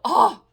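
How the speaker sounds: sudden loud fangirl exclamation; real overflowing excitement; loud excited "oh!". Real, not acted. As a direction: burst out with a big oh. Fangirling over Baby Grogu.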